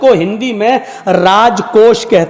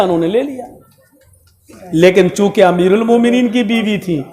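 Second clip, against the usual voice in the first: usual speaking voice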